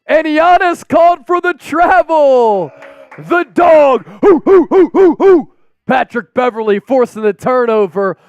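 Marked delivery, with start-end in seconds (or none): 0.1-4.1 loud, high shouted call; excited play-by-play. Real, not acted. Live call of a travel violation. 4.2-5.5 loud, high hooting barks; celebratory dog barks. Real, not acted. Barking for "the dog" after turnover.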